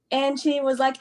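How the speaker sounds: ordinary talk, nothing marked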